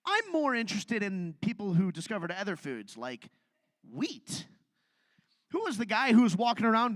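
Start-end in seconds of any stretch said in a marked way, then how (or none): none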